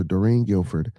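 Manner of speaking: ordinary talk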